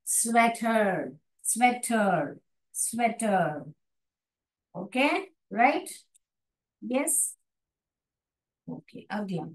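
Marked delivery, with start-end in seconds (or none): 0.1-3.7 स्वेटर said slowly with a short e; slow sounded word. Shown as a demonstration. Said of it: "एह करके पढ़ना चाहिए".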